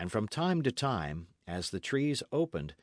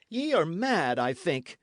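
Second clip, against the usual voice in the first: raised, exasperated cry; cried out; 7 semitones higher, 4 dB louder than usual. A narrator's reading. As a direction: cry out at the lad. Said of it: "he cried".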